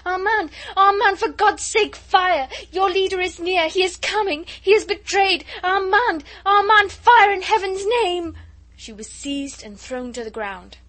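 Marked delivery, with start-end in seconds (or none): 0.1-8.4 screaming high and loud; frantic shout. Acted. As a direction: shriek a warning through the walls. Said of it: "while she shouted".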